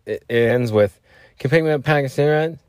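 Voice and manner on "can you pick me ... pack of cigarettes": a hint of a wheedling ex, higher and quick; imitated junkie ex-girlfriend. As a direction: ask a favor in her higher voice. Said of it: "like the junkie ex-girlfriend".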